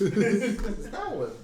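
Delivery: slow, high laughing voice. Real, not acted. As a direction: point it out through laughter.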